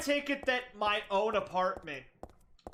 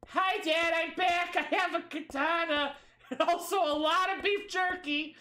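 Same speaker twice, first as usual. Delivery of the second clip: put-on bright kid announcing she's home; cheerful girl voice; 7 semitones higher than usual. Voicing character greeting dad.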